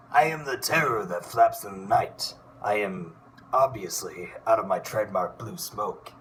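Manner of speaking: bold cartoon duck hero declaiming his entrance; Darkwing Duck voice. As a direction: grandly announce each line like a caped duck hero. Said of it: "Darkwing Duck".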